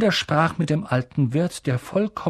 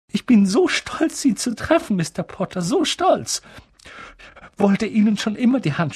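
higher-pitched, gushing excitement; overwhelmed admirer; 6 semitones higher than usual. Acted. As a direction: gush as if giddy at meeting an idol. Fans greet Harry, overwhelmed, giddy.

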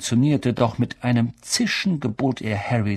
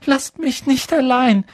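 much higher, louder frightened cry; pleading cry; 6 dB louder than usual. A narrator's reading. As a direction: beg them not to leave. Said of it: "rief Neville".

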